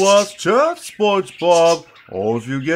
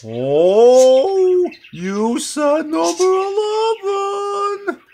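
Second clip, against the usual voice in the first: dawning realization, stretched out; surprised realization; 10 semitones higher, much slower than usual. Acted. Patrick realizes mistake.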